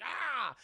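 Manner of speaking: high-pitched mock roar; short comic kaiju screech; imitated Godzilla cry. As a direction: roar back like a monster in a fight.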